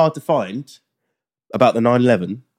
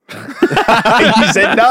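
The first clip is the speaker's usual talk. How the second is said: slow, high laughing words; 11 semitones higher, 5 dB louder, much slower than usual. Real, not acted. Caveman guessing game, laughing.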